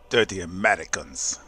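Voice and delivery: hammy fake Russian accent. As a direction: sneer it in a bad Russian accent. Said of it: "Excuse my horrible Russian accent".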